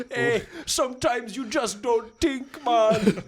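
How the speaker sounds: slow, high goofy dim contractor; dumb contractor voice. Retold or imitated. Friend mocking clueless contractors, goofy high slow voice. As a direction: laugh through a slow, dopey high voice.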